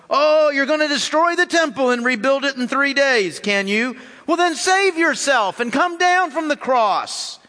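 mock him for his temple claim; mocking abuse. A narrator's reading. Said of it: "shouted abuse".

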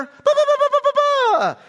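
high, loud kid's mocking "ba, ba, ba"; mocking babble. Preacher mimicking a little brother's mocking babble. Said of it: "Doesn't it sound like a little brother?".

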